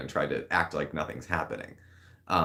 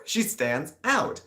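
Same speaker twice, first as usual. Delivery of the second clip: she stands out, slow, rising with a laugh; laughing repeat; 9 semitones higher, slower than usual. Shown as a demonstration. Repeating the point through a laugh. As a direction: say it again, amused.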